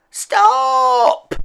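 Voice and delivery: put-on girl shouting to stop the chaos. A narrator's reading. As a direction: yell it to halt everything. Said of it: "shouted Izzy at the top of her voice".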